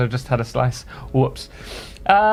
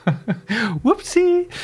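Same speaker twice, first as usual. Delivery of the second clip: high squeaky whoopsie; 9 semitones higher than usual. Real, not acted. Accidental ad start.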